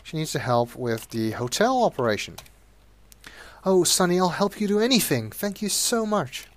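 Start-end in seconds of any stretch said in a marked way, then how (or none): 3.6-6.5 much higher, sugary pleading voice; pleading hooker. Acted. Sweet Cheeks begs Sonny from her cell, high and sugary. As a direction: coo at him to win his help.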